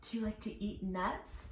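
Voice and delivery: ordinary delivery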